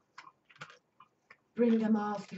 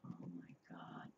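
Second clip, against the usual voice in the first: much softer whispered awe; awed whisper; 12 semitones lower, 18 dB quieter than usual. Real, not acted. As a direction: barely breathe the words. Awed by the message.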